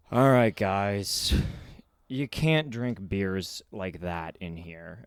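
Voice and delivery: low, slow, patient; calm bouncer. Retold or imitated. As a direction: speak like a big bouncer keeping his cool. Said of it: "took in this deep breath, let it out".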